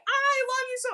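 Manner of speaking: very high, imitated overwrought voice; retold heartbroken gushing. Mom reassuring her hurt son, high overwrought gushing. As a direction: squeak it out as if heartbroken. Mom's emotional reply retold.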